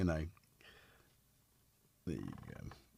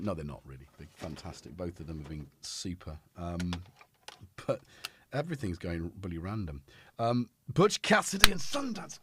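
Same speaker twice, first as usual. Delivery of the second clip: ordinary talk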